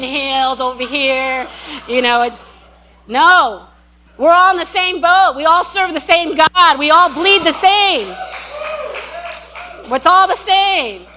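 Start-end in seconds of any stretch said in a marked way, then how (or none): 4.2-11.1 emphatic preaching. Real, not acted. Preacher building to emphatic unity.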